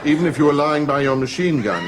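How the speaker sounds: usual speaking voice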